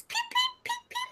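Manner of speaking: high, soft little bird peeps; cute bird peeping. Pretend. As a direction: peep like a tiny cute bird. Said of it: "a fun bird voice".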